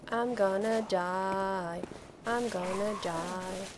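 slow, voiced lament on DIE; drawn-out doom. Real, not acted. Gamer facing death in game, drawn-out doom. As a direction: stretch out gonna and die.

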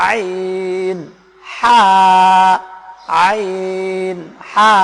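long, held আইন and হা from one throat spot; mid-throat articulation. Shown as a demonstration. Teacher showing how ain and ha sound, drawn-out throat articulation. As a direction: chant আইন drawn out, then a strong throaty হা. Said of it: "আইন আর হা একই জায়গা থেকে উচ্চারণ হয়".

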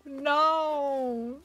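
high, drawn-out mock wail; mock crying. Streamer upset at finding Chops, mock crying. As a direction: wail "no" in playful despair. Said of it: "I'm crying".

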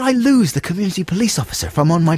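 a hint of a getaway fantasy; mock scheming. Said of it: "I was thinking".

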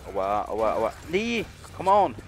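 loud, high panicked yelling; being chased. Real, not acted. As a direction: yelp in pain and panic. Chased in game, pained yells.

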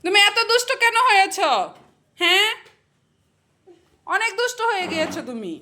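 high, lifted scolding. Real, not acted. Mother asks toddler why so naughty, chiding.

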